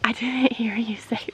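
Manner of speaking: whispered, soft teasing; whispered reply. Real, not acted. Wife whispering back to her husband, teasing. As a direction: tease him under your breath.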